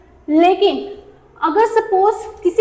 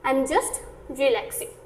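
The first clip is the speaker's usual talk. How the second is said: slow teaching read; 3 semitones lower, 8 dB quieter, much slower than usual. Shown as a demonstration. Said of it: "simple तरीके से हम बोलेंगे".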